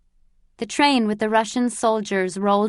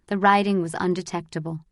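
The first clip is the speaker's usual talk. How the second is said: plain reading voice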